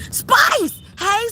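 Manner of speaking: high, loud call. Pretend. Imagining calling Spice, shouting. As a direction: yell the name across the yard.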